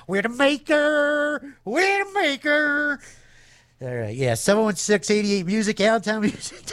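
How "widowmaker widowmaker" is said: high, slow, drawn-out title; dramatic repeat. Pretend. DJ savouring a song title, high and slow. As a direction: savour the name ominously.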